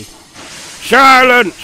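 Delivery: loud, high, angry bark; sharp snap. A narrator's reading. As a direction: snap it to silence the engines. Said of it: "snapped the Fat Controller".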